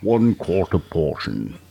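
slow, put-on alien junk dealer; drawn-out Star Wars character voice; Unkar Plutt. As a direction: pronounce the offer slowly in character. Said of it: "who Unkar Plutt is".

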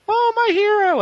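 very high swooning fan voice; mock adoring fan. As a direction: squeal like a smitten admirer. Voices adoring public in recap.

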